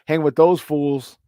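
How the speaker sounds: ordinary talk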